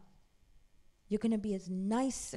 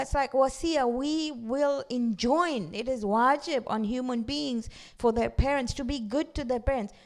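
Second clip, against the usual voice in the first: ordinary delivery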